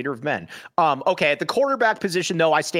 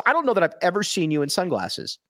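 ordinary delivery

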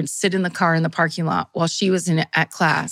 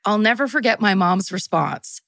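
usual speaking voice

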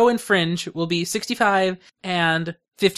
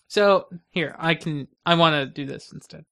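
ordinary talk, nothing marked

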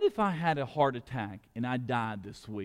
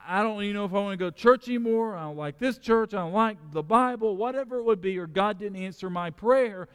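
rising, louder sermon voice building to emphatic peaks; full-force preaching; 8 semitones higher, 5 dB louder than usual. Real, not acted. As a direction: preach it out to the whole church with conviction. Pastor preaching to the congregation.

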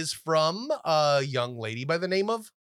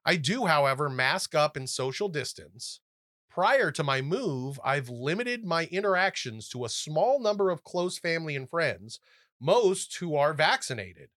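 softer, careful letter read; 2 semitones lower, 4 dB quieter than usual. Acted. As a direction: read the listener's letter gently. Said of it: "Millie writes us".

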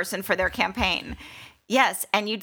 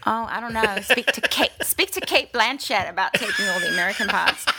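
joking Australian retort, laughing partway through; Australian accent. Pretend. Actress jokes back about cultural appropriation, Australian accent. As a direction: protest playfully in an Australian accent.

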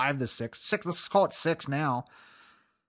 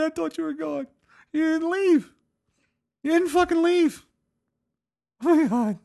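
high, loud sobbing plea; crying wail; 5 dB louder than usual. Retold or imitated. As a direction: wail at her through the tears. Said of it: "So I cried".

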